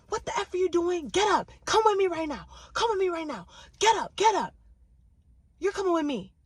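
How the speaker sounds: high, loud retold shouting; angry woman ordering him out; rowdy yelling. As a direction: yell at him to get out now. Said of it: "But then she gets rowdy".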